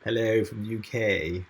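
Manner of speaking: slow put-on English accent; lightly put-on English accent greeting. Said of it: "I love your English accent".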